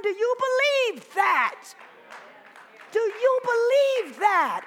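slow, loud booming question from God. Retold or imitated. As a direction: ask it slowly like God calling. God's voice, preached climax.

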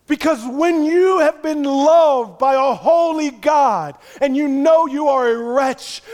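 loud, high pulpit preaching; fiery sermon climax; preaching at full force. Acted. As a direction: drive it home to the congregation.

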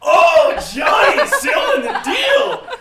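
high, loud, gleeful whoop; teasing cheer. Real, not acted. Host teases friend about the boyfriend, high jubilant shout. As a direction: shout it like a big celebration.

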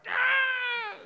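a tiny creature's piercing high shriek, full blast; shrill mouse scream. Retold or imitated. Pastor imitating the mouse in his dad's hands with a piercing shriek. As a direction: scream like a mouse being skinned. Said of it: "the mouse is like".

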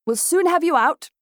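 a gentleman cheerfully shouting from an overturned carriage; cheerful cry. A narrator's reading. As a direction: cheer everyone up from the wreck. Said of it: "he cried with admirable cheer".

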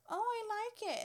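high, sweet, fake-nice voice; sugary fake approval. Imitating the rude client, high and sugary. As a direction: mimic the rude lady's sudden sweetness.

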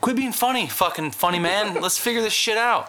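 annoyed retort. Retold or imitated. Retold irritated reply.

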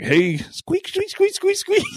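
high mock squeaks of a pump; playful high pump squeaks; squeaky pump noise.

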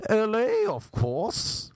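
high-starting affected mock-English reply; theatrical English-sounding man. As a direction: mimic a theatrical put-on voice. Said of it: "that's not your real voice".

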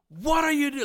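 high, loud shout of confrontation; boss yelling. Retold or imitated. Boss walking up to the box, mock startled yell. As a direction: holler it as the boss catching someone out. Boss walks up on squatting guy.

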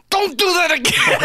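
very high-pitched strained mock shout; angry outburst. Man yelling at his laughing companions after falling over, angry outburst. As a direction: reenact an embarrassed, angry yelp. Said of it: "And I said it angry".

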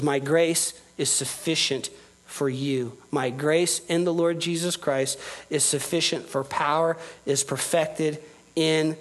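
a quiet, slow divine promise; God's gentle promise. Acted. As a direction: lay each word down gently as God comforting his child. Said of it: "what God is saying to us".

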